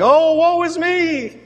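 high, slow, moaning "Oh, woe is me"; mock the grumblers with a drawn-out whine; whiny complaint. Preacher mocks complaining Israelites, high slow whine. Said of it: "They were saying".

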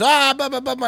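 high, mocking old-man jabber; mock angry babble. Old driver ranting back in the street, high mock babble. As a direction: imitate him ranting in high nonsense. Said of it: "He goes".

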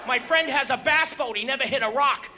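ordinary talk, nothing marked

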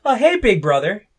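high, loud, hammed-up hello; mock sibling greeting. Parodying a greeting no real sibling uses, overdone. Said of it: "Just like no one's like".

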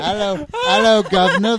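loud, high hammed-up British greeting; badly faked British accent, played big. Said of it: "chime in with a British accent".

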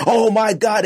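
ordinary delivery

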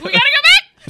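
high, loud hammed-up urgency through laughter; laughing mock urgency. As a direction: play the panicked call, laughing. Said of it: "He's like".

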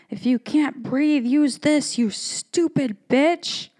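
high, sneering insult from a stranger; mean lady. Retold or imitated. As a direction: spit it out like the lady who threw the mask. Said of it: "and she says".

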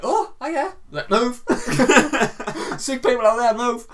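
high, hurried hint of a receptionist shooing; mock door nurse. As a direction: shoo her in with a high, laughing voice. Woman at door urges her in.

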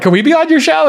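high, hopeful mock plea; mock eager asker. Host imitating the platform asking to be on his show, high and pleading. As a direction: ask it like an eager fan. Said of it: "the platform was like".

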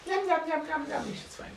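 very high, squeaky little gnome; munching gnome. Acted. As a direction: chant it greedily while smacking on sugar. Gnome keeps munching, ignoring the dwarf.

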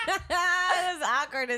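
high, slow, laughing through it; real laugh over the awkward ride. Real, not acted. Laughing recollection.

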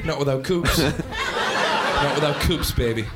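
very slow, low mock-tough bouncer drawl. Teen playing bouncer for the girls, slow hammy tough-guy line. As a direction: play a cool bouncer, hammed up.

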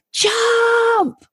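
a sudden high shout, right in the ear; loud shout. Retold or imitated. The mysterious voice shouting a warning right in his ear. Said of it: "and the voice went".

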